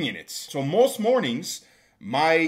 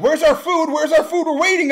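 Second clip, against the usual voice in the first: high, loud mocking whine of impatient servers; 13 semitones higher, 8 dB louder than usual. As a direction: whine it like a pushy waiter. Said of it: "They're like".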